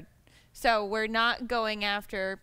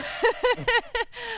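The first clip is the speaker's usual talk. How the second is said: high, loud burst of laughter; 13 semitones higher, 5 dB louder than usual. Real, not acted. Panelist admits only two states so far, high laugh. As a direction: break into real, high-pitched laughter.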